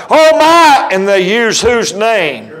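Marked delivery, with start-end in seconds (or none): 0.1-0.9 sudden high cry of pretend fright; acted panicky outburst; blurted mock alarm. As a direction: blurt it out as if about to crash. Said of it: "it blurts out".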